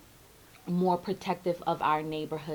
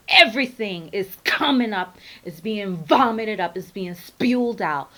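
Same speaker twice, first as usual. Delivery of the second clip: loud; 8 dB louder than usual. Real, not acted. Intense spiritual rant rising.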